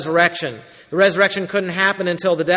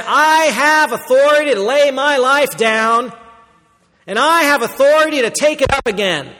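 ringing declaration; 7 semitones higher, 5 dB louder than usual. Acted. Quoting Jesus, declaring authority.

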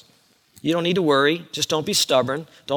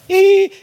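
a high, loud little squeal of embarrassment; mock squeal; 8 dB louder than usual. Corinthians squealing on reading the letter, mock squeal. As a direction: squeak like a caught-out listener.

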